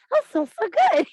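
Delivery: squealed high and loud; giddy over a birthday candle in the tent; giddy delight. Retold or imitated. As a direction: squeal it like a happy kid. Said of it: "I'm like".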